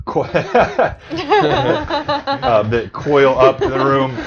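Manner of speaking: giggling through the words; cracking up mid-sentence; laughing. Real, not acted.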